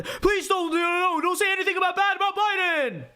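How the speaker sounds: shrieking mock plea, pitched very high; put-on frantic high squeal; hammed-up panicked impression. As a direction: wail it in exaggerated panic, drawing out the end. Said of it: "Shank impression".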